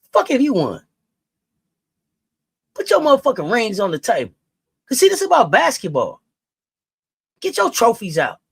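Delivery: furious rant. Real, not acted. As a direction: tear into the critic.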